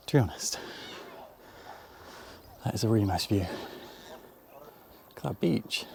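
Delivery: breathless, slowed words while panting. Real, not acted. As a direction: gasp the words out after a climb. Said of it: "Me panting".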